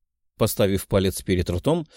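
ordinary delivery

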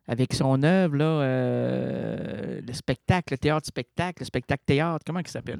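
ordinary talk

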